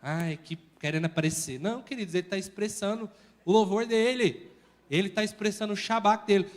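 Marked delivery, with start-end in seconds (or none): 0.0-1.6 soft, sneering grumble; mocking complaint. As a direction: imitate a bored critic. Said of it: "Às vezes você olha e fala".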